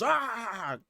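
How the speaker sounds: high "ah" of a leaping creature; mock attack cry. Cupcake jumping at the kid's face, mock attack cry.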